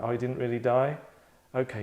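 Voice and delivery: ordinary talk, nothing marked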